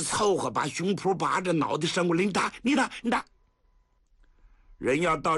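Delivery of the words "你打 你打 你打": taunting dare. Acted. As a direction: push your chest out and dare him. Sticks his head out, taunting. (挺胸挑衅)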